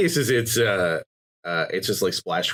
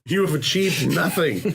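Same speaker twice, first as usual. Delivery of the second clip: mock announcer voice; 8 semitones higher than usual. Mocking achievement screen.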